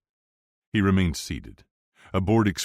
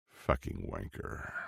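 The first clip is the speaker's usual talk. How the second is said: muttered curse; 11 semitones lower, 11 dB quieter, slower than usual. A narrator's reading. Bodyguard's silent insult thought.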